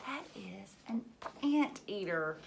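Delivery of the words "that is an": suspenseful whisper before the reveal. Pretend. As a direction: hush them, then reveal.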